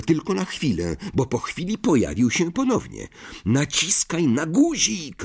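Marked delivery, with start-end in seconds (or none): 3.4-5.2 high, drawn-out urgent call; narrated roar. A narrator's reading. Ron orders Harry to press the button, contained roar. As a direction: urge it out, pitched well up. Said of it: "ryknął Ron".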